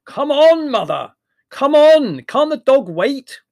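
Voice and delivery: full high, impatient shout; bellowing. A narrator's reading. Larry yells from the cab to Mother, bellowing. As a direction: bellow across the street. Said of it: "and bellowed".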